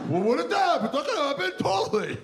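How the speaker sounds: high squeaky mock alarm. Comic asking the audience about the ham, high mock alarm. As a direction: play it shocked and breathless.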